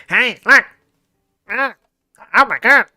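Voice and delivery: imitating a bully pinching his nose, high and nasal-sounding; pinched-nose mock voice. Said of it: "he would pinch his nose".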